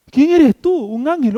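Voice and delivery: high-pitched amazed question; playfully astonished, pitched well up; startled surprise. Acted. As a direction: act out wide-eyed disbelief at a visitor. Acts out Zacarías startled by angel.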